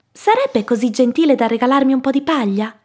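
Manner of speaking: small pig politely pleading; polite little pig. Acted. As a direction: ask a favour like a well-mannered piglet. Little pig asks for straw.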